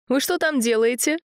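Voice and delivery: high strict telling-off; stern grandma call. A narrator's reading. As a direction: scold the boys sternly. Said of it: "Строгий окрик бабушки".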